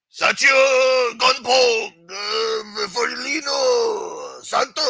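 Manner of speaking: bellowing names like they do; mock name-calling shout. Streamer imitating rivals calling out names, loud mock shouts. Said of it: "when they call out names".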